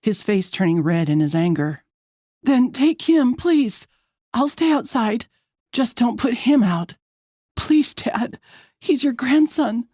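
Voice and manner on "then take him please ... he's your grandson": high, desperate begging; desperate daughter, pitch raised; choked-out plea. A narrator's reading. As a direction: plead for your baby's shelter. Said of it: "I choke out".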